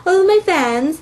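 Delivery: high, airy-headed sing-song imitation; hammed-up high character voice; mock letter-writer. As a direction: pitch it high, mocking someone writing to fans. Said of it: "and they're like".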